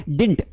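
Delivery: didn't as one syllable, DINT; correct pronunciation. Shown as a demonstration. Teacher has all children repeat, didn't said DINT. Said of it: "मेरे साथ बोल के देखेंगे".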